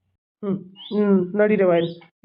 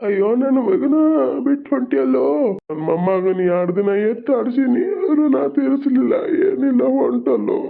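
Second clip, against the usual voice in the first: grieving wail; 5 semitones higher than usual. Acted. Relative crying out that the child has left, high keening wail. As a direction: wail out the loss.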